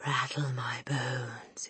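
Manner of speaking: slow, whispery ghost voice; soft and spooky. A narrator's reading. Hidden voice haunts the family, soft and spooky. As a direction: breathe it out eerily, drawn long. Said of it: "soft and spooky this time".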